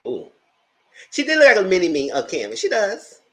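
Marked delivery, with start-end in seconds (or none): 1.1-3.2 excited. Real, not acted. Excited about lookalike.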